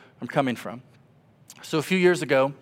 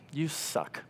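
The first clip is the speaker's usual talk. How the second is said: flat bitter jab; 11 dB quieter than usual. Retold or imitated. Blunt opening of angry prayer.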